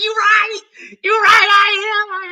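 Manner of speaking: high, loud crying-out agreement; hammed-up admission. Speaker conceding to Monique's joke, high loud wail. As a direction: wail it like caught out. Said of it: "I was like".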